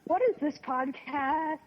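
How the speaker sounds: laughing disbelief. Real, not acted. Laughing at their own intro.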